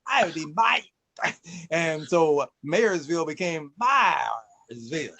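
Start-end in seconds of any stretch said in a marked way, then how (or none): 0.0-0.8 imitating a European accent, long A as I; mock European "Howdy, mate" with the A as I; higher, louder European accent impression. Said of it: "a long A sound sounded like an I".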